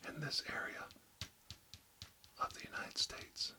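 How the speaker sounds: ASMR whisper, close to the mic; barely audible, intimate whisper. Acted. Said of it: "real close to inaudible whispers today".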